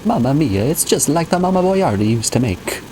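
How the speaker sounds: mock Italian with a big "Mamma mia"; hammed-up Italian accent. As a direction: play it like a pasta ad. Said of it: "my Italian accent".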